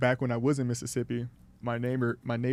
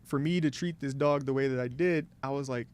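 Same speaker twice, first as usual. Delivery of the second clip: usual speaking voice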